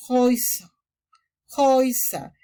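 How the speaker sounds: Häuser said hoy-zer, the äu like oi; German pronunciation. Shown as a demonstration. Teacher modelling Häuser with an oi sound. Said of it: "vocaliza como oi".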